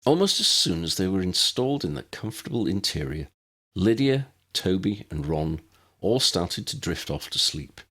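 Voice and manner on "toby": ordinary delivery, nothing marked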